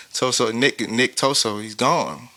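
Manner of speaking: ordinary talk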